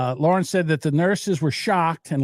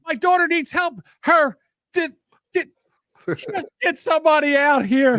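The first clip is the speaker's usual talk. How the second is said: frantic parent; 11 semitones higher than usual. Retold or imitated.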